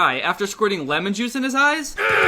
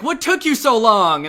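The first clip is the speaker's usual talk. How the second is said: louder, higher mock scolding; mock angry wife; 5 semitones higher, 6 dB louder than usual. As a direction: snap at him like an annoyed wife. Mother scolding late husband.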